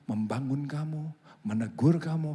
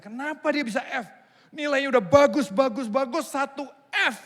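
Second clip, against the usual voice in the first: indignant professor; 9 semitones higher, 6 dB louder than usual. Retold or imitated. Professor outraged at grade.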